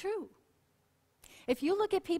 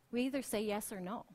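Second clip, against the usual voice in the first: normal talking voice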